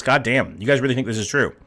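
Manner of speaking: ordinary talk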